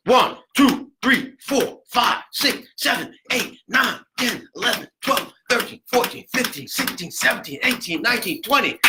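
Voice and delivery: high, loud rhythmic count; punched numbers, driving pulse. Shown as a demonstration. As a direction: count compressions out to a steady beat. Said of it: "You gotta count out loud".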